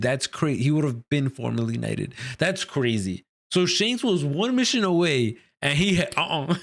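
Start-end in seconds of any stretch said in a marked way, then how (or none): none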